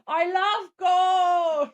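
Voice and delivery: slow, high, drawn-out Dutch villain; Goldmember voice. Retold or imitated. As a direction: stretch every word like a greedy villain. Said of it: "It's like a Goldfinger from, no, Goldmember".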